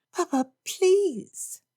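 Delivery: very high, slow pleading; drawn-out plea. Acted. Lydia begs her father, very high slow plea. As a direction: stretch the please out.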